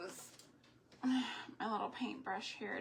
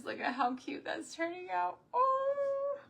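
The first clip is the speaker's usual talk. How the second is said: high delighted gush over the bunny; thrilled high-pitched delight; delighted squeal; 9 semitones higher, 4 dB louder than usual. Real, not acted. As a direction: gush at how cute it is. Delighted at craft result.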